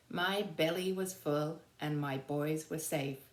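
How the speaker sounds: ordinary delivery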